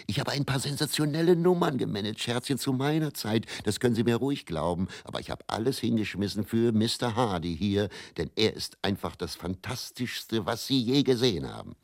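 a cheery manager talking up his act in a bar; bright, quick pitch from a London impresario; Teddy's sales patter. Retold or imitated. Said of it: "Wenn ich ihn so hörte".

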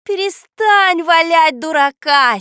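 loud, high, drawn-out yell; angry shout. A narrator's reading. Girl orders boy to stop fooling, angry shout. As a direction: stretch the command out furiously. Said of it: "сердито закричала Катя".

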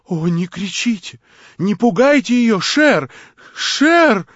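громко и высоко, в панике; закричи в страхе; испуганный крик. Acted. Варвара в испуге кричит. (испуганный крик)

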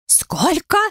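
high shocked squeal. A narrator's reading. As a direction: squeal it in disbelief. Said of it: "взвизгнул Гарри".

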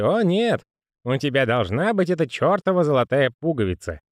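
lightly voiced grumbling old man; a hint of a fretful old postman.

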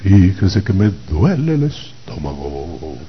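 slow, drawn-out imitation of pastor-speak; mock pastor talk. Imitating the pastors recognized by their way of talking, mock pastor talk. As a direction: stretch it out like a parody pastor. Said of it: "El habladito".

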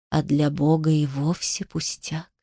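soft, low serpent voice; hissing snake. A narrator's reading. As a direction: speak low and hushed like a snake. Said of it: "прошипела змея". (тихий, низкий змеиный голос)

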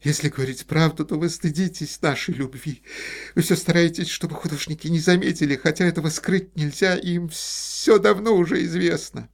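high, loud, crying reproach; tearful accusation. A narrator's reading. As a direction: pour out the hurt through tears.

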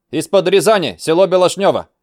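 put-on young recruit reply. Recruit answers instructor.

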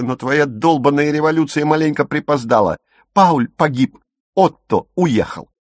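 high, heated, insistent tirade; irritated retort. A narrator's reading. Dagmar telling Silke the twins loved her, heatedly. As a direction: fire back with mounting exasperation. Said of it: "раздражённо отмахнулась Дагмар".

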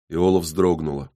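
ordinary delivery, nothing marked

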